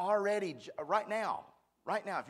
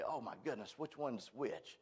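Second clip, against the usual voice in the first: muttered confusion; 4 semitones lower, 10 dB quieter than usual. Retold or imitated. Preacher recalling his muddle over terms, muttered confusion. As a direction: mutter it softly to yourself, puzzled.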